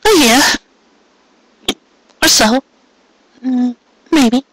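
put-on high young mare's voice, trailing off unsure; young mare, hesitant. A narrator's reading. Mare tells Carrot how long it will take, hesitant. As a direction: voice her high and tentative.

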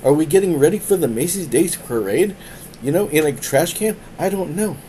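high, loud, sarcastic rant at the friend; exasperated rant. Retold or imitated. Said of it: "and I'm like".

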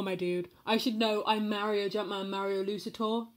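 usual speaking voice

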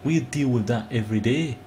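attempted Montanan that lands as Scottish; mocking Scottish-tinged accent; botched Montanan accent, Scottish-sounding. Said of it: "that's a Scottish accent".

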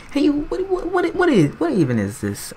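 high, baffled stammering. Real, not acted. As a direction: squeak out confused whats. Confused outburst.

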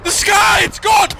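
high terrified cry; panicked shout. Real, not acted. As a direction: yell out in real fear. Panicking, sky has vanished.

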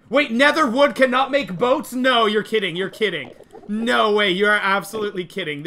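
can't believe the news, protest it; shocked disbelief. Real, not acted. Shocked at nether wood boats.

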